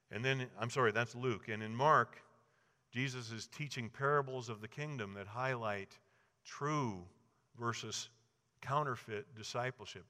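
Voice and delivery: ordinary delivery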